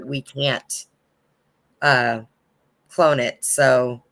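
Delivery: usual speaking voice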